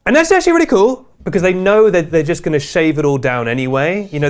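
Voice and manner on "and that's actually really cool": high, loud bursts with gaps between; pre-edited vlogger delivery. Retold or imitated. Host mimicking creators who record in chunks, high pre-edited bursts. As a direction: fire off each phrase as a separate clip. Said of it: "they speak in a way that almost preempts the edit".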